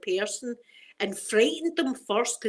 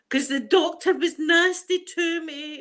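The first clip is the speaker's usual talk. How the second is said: higher, strained voice as if near tears; upset; 8 semitones higher, 5 dB louder, slower than usual. Real, not acted. Telling viewers the doctor upset her, tearful. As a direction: let the hurt crack through. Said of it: "With the crying I was doing".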